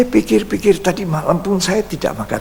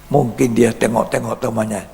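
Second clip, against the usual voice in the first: usual speaking voice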